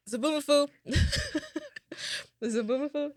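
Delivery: high-pitched, giggling name; laughing. Real, not acted. Fan on whether Zoboomafoo is still alive, high laughing voice. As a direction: break into real giggles around the name.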